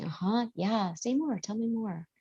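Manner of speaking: quiet, gentle prompts; barely there, just keep them talking; soft encouraging murmur. Shown as a demonstration. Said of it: "And you just say".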